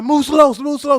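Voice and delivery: loud, high warning command; officer yelling a tense warning; retold shouted order. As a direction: shout it like you're covering a suspect. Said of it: "So he's like".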